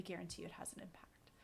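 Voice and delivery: fast, breathy whisper on "absolutely". Real, not acted. Host whispers the guarantee to listeners for emphasis. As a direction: hush it like a secret you swear by. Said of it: "unvoiced whisper".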